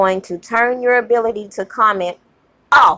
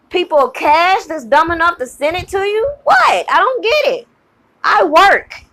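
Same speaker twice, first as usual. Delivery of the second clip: rising outrage at the donors' foolishness; exasperated anger; 6 semitones higher than usual. Real, not acted. Ranting at rival bloggers' fans, high exasperated anger. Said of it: "I am heated".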